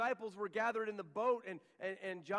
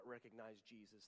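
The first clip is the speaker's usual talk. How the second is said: hushed, low; 9 semitones lower, 14 dB quieter than usual. Acted. Preacher lets the point land.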